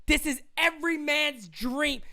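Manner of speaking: ordinary talk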